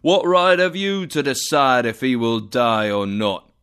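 the spirit scolding Scrooge, loud and stern; angry spirit. Acted. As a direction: rebuke him with cold anger. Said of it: "The spirit spoke angrily to Scrooge".

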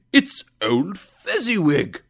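high, loud astonished exclamation; amazed cry. A narrator's reading. Scrooge sees his old employer, amazed. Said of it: "Scrooge cried in great amazement".

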